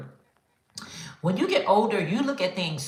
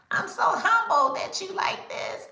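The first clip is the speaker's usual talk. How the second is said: much higher, sweetly humble; mock humble squeak. Maker thanking a customer, high bashful squeak.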